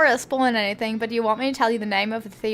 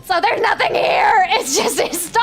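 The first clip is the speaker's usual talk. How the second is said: high, louder cry of disbelief; exasperated outburst; 10 semitones higher, 5 dB louder than usual. Acted. Player finding just a story and no treasure, exasperated outburst. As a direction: burst out in mock outrage.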